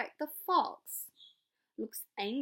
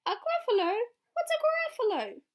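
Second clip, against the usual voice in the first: much higher, loud squawking question; screeching baffled owl; shrill startled owl; 12 semitones higher, 12 dB louder than usual. Acted. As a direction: owl thrown by the name. Owl puzzled.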